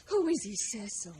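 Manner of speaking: ordinary talk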